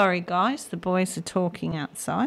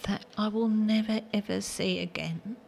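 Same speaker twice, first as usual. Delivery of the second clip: ordinary delivery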